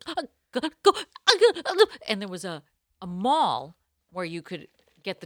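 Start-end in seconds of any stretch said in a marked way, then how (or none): 0.1-1.8 high, loud anxious repeat; edging out in a hurry; mock panic. Late for class, fleeing the salon in a high anxious voice. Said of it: "I started to slowly go".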